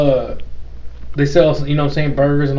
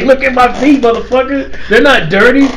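mock indignant protest, raised pitch; hammed-up defiant outburst; retold defiance; 6 semitones higher than usual. Acts out her defiant protest.

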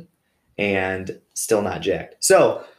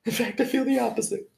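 much higher, quicker confession; self-deprecating squeak; 4 dB quieter, faster than usual. Real, not acted. Admitting insecurity to listeners, high squeaky self-mockery. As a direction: squeak it out like an embarrassed admission.

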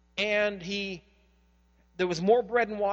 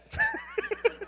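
a sudden high burst of laughter; hearty laugh. Real, not acted. Pastor to congregation, laughing out at the trucker's line.

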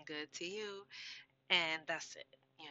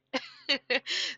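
high, loud burst of laughter; laughing; 10 semitones higher, 9 dB louder than usual. Real, not acted. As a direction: break into a bright laugh. Laughs after a sales pitch.

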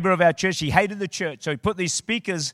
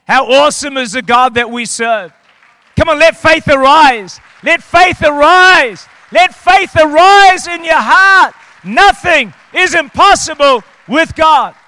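high, loud, slow rousing shout; preaching at full force; 9 semitones higher, 16 dB louder than usual. Real, not acted. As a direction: lift the room with faith. Preacher builds to climax.

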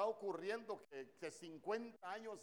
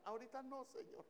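high, small voice dropping away; imitated church sister; 8 semitones higher, 4 dB quieter, faster than usual. Congregant interrupting the pastor's prayer, high mock plea. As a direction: mimic a reluctant worshipper's little aside. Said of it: "Y sale una hermana".

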